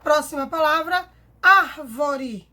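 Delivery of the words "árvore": árvore with a loud first syllable; stress on ÁR. Shown as a demonstration. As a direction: punch the ár in árvore. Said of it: "Sílaba tônica".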